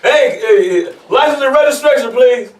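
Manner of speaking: loud, high-pitched cop demand; police officer. Acted. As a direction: shout it like an officer at a traffic stop. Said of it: "when the police officer came".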